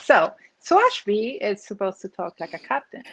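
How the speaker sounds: ordinary delivery, nothing marked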